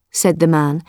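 ordinary delivery, nothing marked

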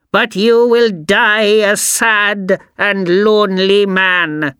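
screaming witch. A narrator's reading. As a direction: screech it after him like a witch. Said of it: "screamed the old woman".

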